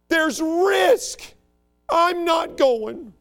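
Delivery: high whiny mock voice, drawn out slow; mocking coward. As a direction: sneer at them like a scared whiner. Mocking today's frightened people.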